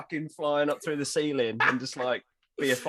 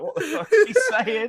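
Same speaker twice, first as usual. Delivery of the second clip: much higher, louder, laughing disbelief; baffled; 8 dB louder than usual. Retold or imitated. Tiny looking at the camera during the French rant, baffled laugh. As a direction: ask it in laughing confusion. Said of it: "looks at the camera like".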